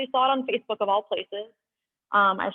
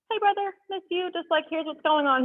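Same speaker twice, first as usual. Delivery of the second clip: retold call; 9 semitones higher than usual. Retelling her call to brother.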